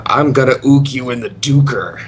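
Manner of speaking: loud, silly boss threat; hammed-up character quote; Stormstout dungeon boss. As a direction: call it out like a goofy game villain. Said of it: "I really liked in Storm Stout".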